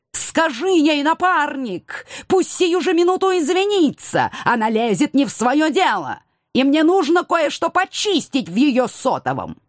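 high, insistent grievance; indignant complaint. A narrator's reading. Cat complaining to his partner about the wife, indignantly.

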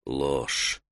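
very low, hushed thought; inward verdict. A narrator's reading. Taita silently detects a lie, hushed inward voice. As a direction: breathe the judgement to yourself.

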